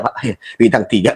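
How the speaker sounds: usual speaking voice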